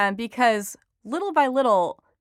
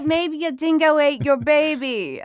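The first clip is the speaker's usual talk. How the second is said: [name]'s mock Australian accent, higher and louder; 7 semitones higher, 4 dB louder than usual. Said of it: "look at the accents with you here".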